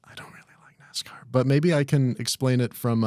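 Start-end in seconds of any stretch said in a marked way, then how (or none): 0.0-1.2 whispering. Real, not acted. Confiding aside about dad.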